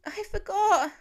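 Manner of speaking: put-on high, guilty child's admission; stricken little boy. A narrator's reading. Little boy admitting to his dad he forgot, high and stricken. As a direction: confess it like a crestfallen kid. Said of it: "eyes stricken".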